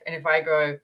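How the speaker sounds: usual speaking voice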